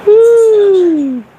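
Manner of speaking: loud high woo; cheer. Real, not acted. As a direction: shout a hype woo. Hype cheer.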